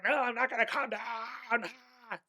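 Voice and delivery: high, loud whiny tantrum, hammed-up; a stomping little kid refusing to calm down; toddler meltdown. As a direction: throw a mock toddler fit. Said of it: "Total toddler meltdown".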